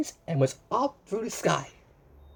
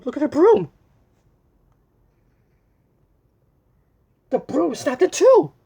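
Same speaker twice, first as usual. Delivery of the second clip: higher and louder, storybook alarm; 1 excited alarm; 8 semitones higher, 9 dB louder than usual. Acted. Reading aloud to a child, excited alarm. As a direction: make the broom break a big event.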